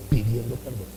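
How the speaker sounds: "pidiendo perdón" dropped to a hush; soft fading repetition. Acted. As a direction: let it fade away softly. Repeated fading phrase. (repetición suave que se apaga)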